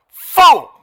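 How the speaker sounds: God's thundering shout of fool; yell. Acted. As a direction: roar it out with full force. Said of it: "word study on that word fool".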